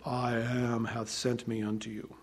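usual speaking voice

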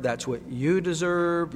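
ordinary delivery

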